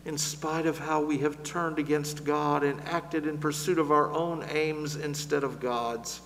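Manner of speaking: ordinary talk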